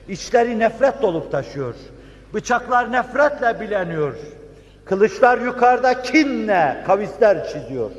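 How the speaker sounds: high, intense preacher's build; fervent preaching. Real, not acted. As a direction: preach it high with rising fervour. (coşkulu vaaz)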